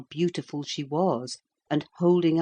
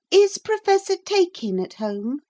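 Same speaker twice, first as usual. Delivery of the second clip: put-on high, polite girl's voice; disguised princess; 6 dB louder than usual. A narrator's reading. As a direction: ask sweetly like a shy girl.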